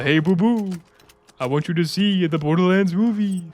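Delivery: cartoon bear talking to his sidekick; [name] as Yogi Bear. [name] as Yogi Bear urging Boo Boo to see the movie. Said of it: "[name] is Yogi Bear now".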